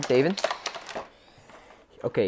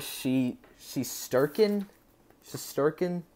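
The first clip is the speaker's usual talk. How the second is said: slow, higher-pitched questioning attempt; unsure pronunciation; 4 semitones higher, 3 dB quieter, slower than usual. Real, not acted. Collector attempting a goalie's name he practiced, unsure and questioning. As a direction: try the name out as a question, drawn out. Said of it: "Something like that, I don't know".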